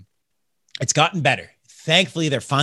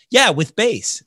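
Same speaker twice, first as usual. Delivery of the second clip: chirpy clerk; 8 semitones higher, 4 dB louder, much slower than usual. Retold or imitated. Counter girl chirpy.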